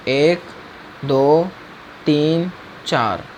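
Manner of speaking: slow counting with long gaps. Shown as a demonstration. Teacher timing each animation step aloud, slow count. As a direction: leave a clear pause after each number.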